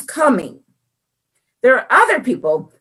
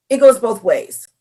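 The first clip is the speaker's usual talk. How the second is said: usual speaking voice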